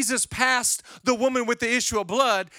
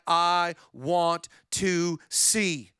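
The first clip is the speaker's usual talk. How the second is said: slow plea; 3 semitones lower, much slower than usual. Acted. Blind man's plea, read.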